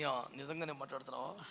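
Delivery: hushed disbelief. Retold or imitated. Pastor's stunned reply, retold.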